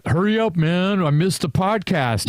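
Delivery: high, pushy mock whine; mock impatient whine. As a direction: play an impatient friend nagging, pitched high. Imagined impatient self-quote.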